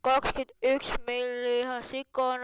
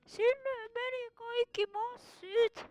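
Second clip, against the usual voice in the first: high, excited cartoon voice all through; a panicky little critter voice, much higher; squeaky put-on voice; 10 semitones higher than usual. Kid gamer in a high silly voice reacting to the fish. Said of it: "miksi mä puhun tuolla äänellä".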